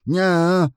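high loud mocking nya; taunting nya. A narrator's reading. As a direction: jeer at them like a cheeky kid. Taunting the enemy.

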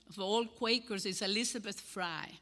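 ordinary delivery

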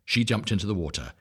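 usual speaking voice

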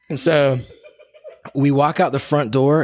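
usual speaking voice

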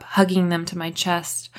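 usual speaking voice

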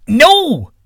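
high, loud shout; desperate bellowed refusal; urgent cry. A narrator's reading. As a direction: cry out to stop someone leaving. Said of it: "cried the sky god".

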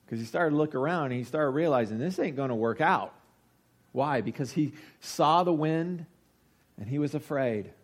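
ordinary delivery

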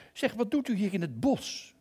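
high, loud lion voice; lion asks the stranger in the woods; lion's voice. Acted. Lion questions the lost narrator, high and loud.

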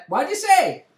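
high, loud yell to another person; loud, high interruption; shouted question. Retold or imitated. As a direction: break off and call out sharply. Interrupts acted praise to shout.

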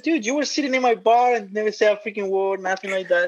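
high-pitched, teasing mock complaint; mock indignation. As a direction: rib a friend in playful disbelief. Retold teasing complaint to friend.

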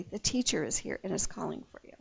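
low, hushed and quick; secretive. A narrator's reading. Martha tells her sister Mary privately, secretively. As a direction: pass it on quickly in a low, soft voice. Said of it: "saying secretly".

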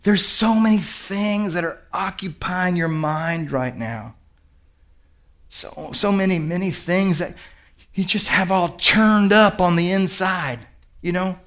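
hushed, slow, sympathetic concern; gentle Jesus. Acted. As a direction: speak softly, lingering on the burden. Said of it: "he says".

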